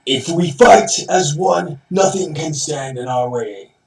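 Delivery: rally the troops, loud and slow; soldier voice. Pretend. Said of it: "my generic soldier voice".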